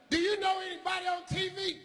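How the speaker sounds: put-on a teacher doubting the kid, pitched high. Teacher quizzing the boy, high incredulous voice.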